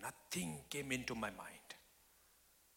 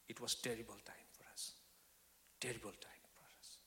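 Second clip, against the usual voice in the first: whispered, much softer; hushed grief; 3 semitones lower, 10 dB quieter than usual. Real, not acted. Preacher remembering the terrible night, whispered. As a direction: let it fall to a whisper.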